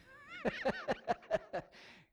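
a big high burst of laughter; real laugh breaking out; laughing hard at his own joke; hearty laugh. Real, not acted.

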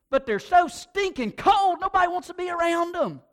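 preacher rising to a peak against cold church folk; loud, high outburst. Real, not acted. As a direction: blast out the point. Preacher condemning cold church people.

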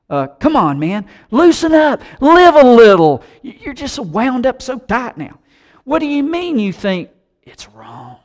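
put-on high, teasing jeer of an old buddy; mocking peer pressure. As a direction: needle him like a friend mocking his new faith. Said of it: "He says".

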